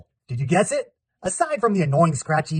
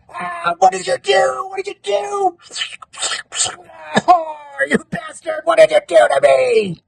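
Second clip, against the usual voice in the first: high, drawn-out agonized yelling; anguished scream; 10 semitones higher, 5 dB louder, slower than usual. Acted. Victim yells at his attacker in execution scene, anguished scream. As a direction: cry out in agony, building to a loud high wail.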